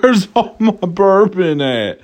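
a hammed-up whimper, half laughing; mock crying whine. Joking to viewers about running out of bourbon, mock whining. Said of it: "I was shedding tears".

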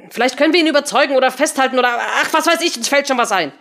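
high, hurried, flustered suggestion; impatient improvising. Acted. Henry improvising a plan for Patricia, impatient and hurried. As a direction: brush it off as it tumbles out.

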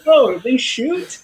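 very high, slow, drawn-out question; mock-innocent surprise. She learns the guns work, mock innocent surprise. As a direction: imitate her playing dumb, stretched and squeaky-high. Retelling her playing dumb.

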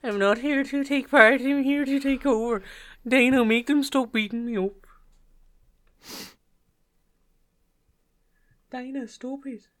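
very high, mocking Irish fighter voice; Conor McGregor. Mocking fighter begging his boss for help, high Irish impression. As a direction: brag loudly, then turn to whiny pleading. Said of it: "I'm just impersonating him".

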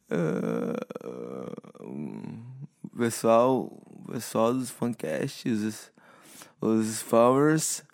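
dopey, slurred and slow; sedated mumble after the dentist; groggy after dentist. Real, not acted. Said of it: "Eu acabei de ir no dentista". (grogue depois do dentista)

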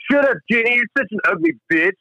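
high, sneering insult; mock Barry. Barry insulting Jenny, high mocking sneer. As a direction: spit it out like a jerk.

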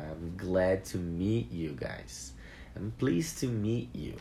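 said slowly and clearly for learners; slow model phrase. Shown as a demonstration. Teacher slowly models another greeting for students. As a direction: spell it out slowly for the class. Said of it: "teacher models phrase".

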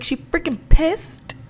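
put-on high, amazed little-kid question; toddler voice. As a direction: imitate the toddler's shocked question. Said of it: "She's like".

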